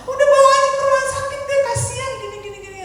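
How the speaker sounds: high, rushed mock-frantic pleading; panicked mother. Wife urging husband to go to hospital, panicked. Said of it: "udah panik, udah nangis-nangis".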